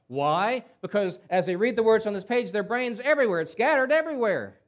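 loud, high, exasperated build; forceful preaching. Real, not acted. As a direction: drive the point home loudly.

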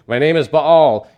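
loud, drawn-out demon reply, lightly voiced; demon giving in. Demon answering the exorcist, loud and slow. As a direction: slowly proclaim the name, a hint of demon. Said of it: "Tell me your name, demon".

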